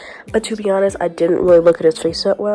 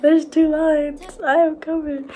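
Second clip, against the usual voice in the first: high-pitched voice through laughter; re-enacted giggly confession, pitched high; laughing announcement; 8 semitones higher, slower than usual. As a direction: break the news while cracking up. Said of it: "I burst out laughing".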